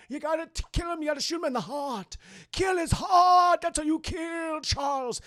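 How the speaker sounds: hammed-up cry, stretching key words; John. John tells how to kill Charles, strained high shout.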